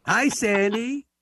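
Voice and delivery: slow, drawn-out hi to the caller; drawn-out greeting. Real, not acted. Host greeting caller Sandy on air, slow and drawn out. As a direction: stretch the greeting out warmly.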